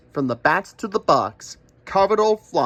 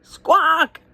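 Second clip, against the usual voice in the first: shrill, much higher bird squawk, louder; crow squawk; 13 semitones higher, 5 dB louder than usual. Acted. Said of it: "said the crow with a grin".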